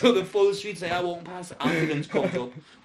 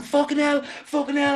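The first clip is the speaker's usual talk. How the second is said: high, loud, panicked screech; retold screaming; 11 semitones higher, 7 dB louder than usual. Girlfriend seeing him fall to the floor, screamed in imitation. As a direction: imitate her screaming at the top of her voice. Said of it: "screamed at the top of her voice".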